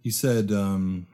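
ordinary delivery

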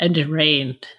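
hushed, low narrator whisper; ominous ride narrator. Retold or imitated. Quoting the old Living Seas narration, low hushed solemnity. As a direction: drop to a low, whispery intonation.

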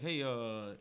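lightly done low, soft nerdy mumble; a hint of the Nutty Professor's timid voice; quiet mock professor impression. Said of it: "the Nutty Professor has that voice".

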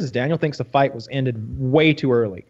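ordinary delivery

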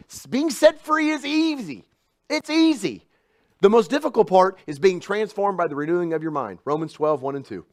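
loud, high preaching build; full-force preaching. Acted. Preacher driving home a point, loud. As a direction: preach it out to the room.

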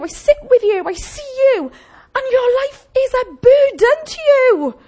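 high, loud, urgent pleading to the church; impassioned preaching. Acted. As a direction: preach it out, every line pressing. Preaching at full force.

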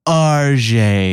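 "R J" drawn out as "ar-zhay"; affected correction. Retold or imitated. Mimicking the registry lady correcting a misheard name, affected pronunciation. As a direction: linger on "R J", softening the J to zh. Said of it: "You misheard me, sir".

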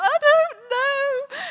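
loud, high, drawn-out wail of helpless laughter; helpless laughing. Retold or imitated. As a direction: wail it slowly, mimicking a giggling fit. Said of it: "I just started laughing".

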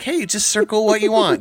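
chipper high parent offer; bright and breezy, handing over the catalog; cheery parent. Retold or imitated. Parents handing kids a toy catalog, cheerful high offer. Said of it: "and we were like".

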